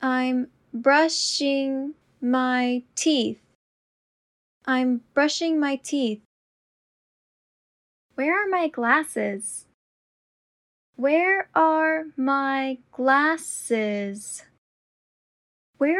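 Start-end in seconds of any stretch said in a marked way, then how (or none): 0.0-3.4 slow and deliberate. Shown as a demonstration. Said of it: "repetition drill, slowed take". 11.0-14.4 very slow and deliberate. Shown as a demonstration. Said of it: "repetition drill, slowed take".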